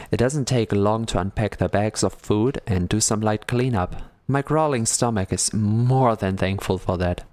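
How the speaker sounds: measured narrator read; story narration. Acted. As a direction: read the novel's lines aloud, calm and steady. Reading visual novel text.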